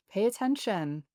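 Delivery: ordinary talk, nothing marked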